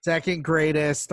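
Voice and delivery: ordinary talk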